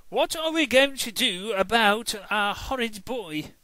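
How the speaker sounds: a fed-up mum wailing at her son; mum's exasperated voice. Acted. Mum despairing about her horrid boy.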